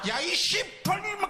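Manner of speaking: high, slow shouted curse; mock curse. Coach cursing at the umpire in Korean, hammed-up yell. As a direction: spit out the swear slowly. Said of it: "하면서 이랬어요".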